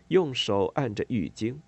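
ordinary delivery, nothing marked

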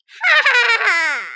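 cackling laugh. Acted. Gremlin's villain laugh.

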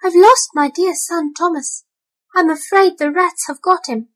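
a mother cat fearing the worst; worried mother cat. A narrator's reading. Tabitha telling Ribby her son is lost, fretful and high.

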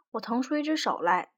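usual speaking voice

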